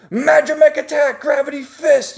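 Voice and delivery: high-pitched anime battle cry; attack call. Retold or imitated. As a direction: proclaim it like a hero's special move. Calls out the hero's attack.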